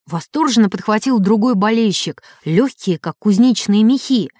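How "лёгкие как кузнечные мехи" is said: ordinary delivery, nothing marked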